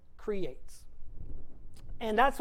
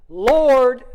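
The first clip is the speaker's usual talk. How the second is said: loud proclamation; 11 dB louder than usual. Retold or imitated. Mocking believer proudly shouts Lord. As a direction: belt out Lord.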